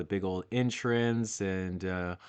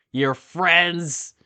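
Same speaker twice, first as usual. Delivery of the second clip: mock outrage; 8 semitones higher, 9 dB louder than usual. Real, not acted. Outraged repeat.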